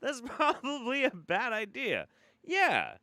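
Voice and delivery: high-pitched sarcastic warning; mock-incredulous advice. Host answering a spam invitation, high mock-incredulous. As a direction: say it as if painfully obvious.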